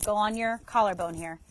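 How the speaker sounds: ordinary delivery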